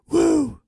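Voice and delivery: sudden high loud wrestler's woo; Ric Flair woo. Retold or imitated. Podcaster does his one Ric Flair impression, a loud high woo. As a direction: bark out a Ric Flair woo like the real thing. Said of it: "the only impression I can do".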